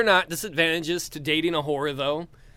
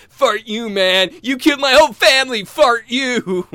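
bad movie dub; 6 semitones higher, 8 dB louder than usual. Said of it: "they do a bad job dubbing".

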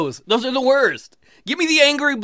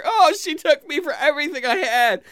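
high, whining lament; hammed-up tearful complaint; mock crying; 6 semitones higher than usual. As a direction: wail bitterly, hamming up the tears. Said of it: "when I'm crying in a corner".

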